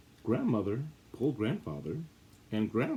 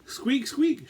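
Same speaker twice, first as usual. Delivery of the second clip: high, louder mouse squeak; 5 dB louder than usual. Acted. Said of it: "she cried".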